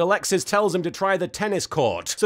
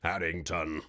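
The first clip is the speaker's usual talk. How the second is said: Harrington said much lower and softer, drawn out; deep mock drawl; 11 dB quieter than usual. Shown as a demonstration. Savoring the silly name in a low mock drawl. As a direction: drop way down and drag out Harrington. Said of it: "sounds like a name you get".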